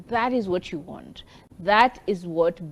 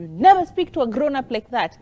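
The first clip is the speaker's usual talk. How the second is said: scolding; 5 semitones higher, 6 dB louder than usual. Retold or imitated. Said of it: "you tell them".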